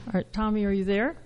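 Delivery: faint, far from the mic; speaking too quietly to hear; barely audible. Real, not acted. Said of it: "I can barely hear you".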